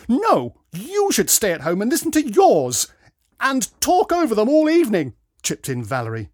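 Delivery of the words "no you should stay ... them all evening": loud, high-pitched comeback; heated retort. A narrator's reading. Valerie chipping in to defend dad, heated retort. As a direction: throw it straight back at her.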